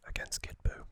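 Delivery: ordinary delivery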